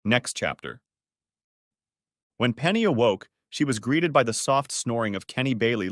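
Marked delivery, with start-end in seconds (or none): none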